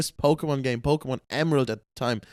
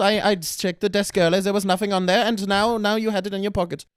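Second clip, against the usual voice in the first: stern mother; 6 semitones higher, 4 dB louder than usual. Retold or imitated. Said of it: "she's like".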